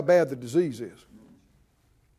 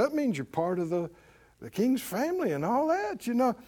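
usual speaking voice

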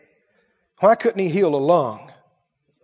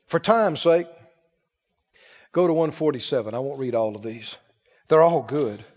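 ordinary talk, nothing marked

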